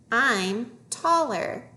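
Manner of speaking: very slow, stretched words; slow reading model. Shown as a demonstration. Teacher modelling a phrase for young readers, slowly. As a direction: draw each word out for learners.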